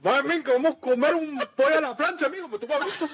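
put-on high, loud Cuban-accented Spanish; Cuban neighbor. Showing friends the neighbor, exaggerated Cuban accent. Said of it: "I'm pretty good at the Cuban accent".

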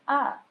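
high, loud kiai ah; martial shout ah. Shown as a demonstration. Teacher showing the wrong karate shout, high and loud. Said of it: "no gritan".

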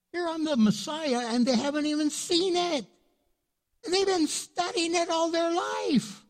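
exasperated disbelief. Retold or imitated. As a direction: sound baffled they missed it. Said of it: "He's like".

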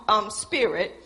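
usual speaking voice

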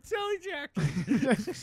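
high, slow, hammed-up pitch; mock salesman voice. Mocking [name] as a tractor salesman, high and slow. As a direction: drag it out like a cheesy ad man.

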